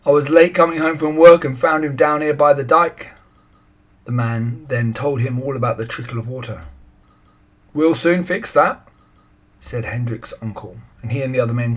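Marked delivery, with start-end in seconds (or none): 0.1-3.1 loud explanation. A narrator's reading. Man explaining loudly. 7.7-8.9 slow, higher confident voice; confident uncle. A narrator's reading.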